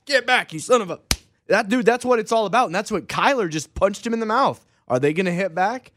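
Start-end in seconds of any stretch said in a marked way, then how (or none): 0.1-1.0 mock angry yell. Acted negotiation shouting.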